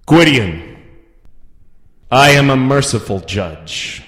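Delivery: loud, booming judge; imperious, ringing declaration; grand pronouncement. Acted. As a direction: proclaim it to the whole court.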